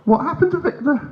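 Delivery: very high, tight frightened question; unnerved questioning. Acted. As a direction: ask it in a scared squeak. Unnerved dad questions son.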